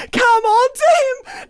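high, loud preacher exclamation; mock preacher. Shown as a demonstration. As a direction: proclaim it like a revival preacher. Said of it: "No, come unto him, sorry".

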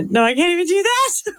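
normal talking voice

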